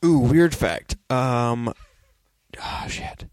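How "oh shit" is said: whisper. Real, not acted. Forgot fact, mutters aside.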